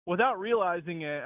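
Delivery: usual speaking voice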